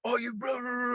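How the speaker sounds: high, loud mock tirade; mimicked rant. Druggo on a bike at a driver, mimicked rant.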